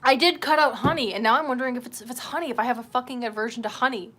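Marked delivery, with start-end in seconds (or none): none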